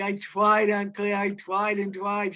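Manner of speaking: slow, miserable pleading; a boy owning up in misery, near tears. A narrator's reading. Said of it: "said Lenny miserably".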